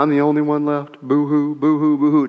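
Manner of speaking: mock boo-hoo sobbing; mock crying. Preacher mocking Elijah's self-pitying complaint, mock sobbing. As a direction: fake a whiny sob. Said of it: "He just started complaining".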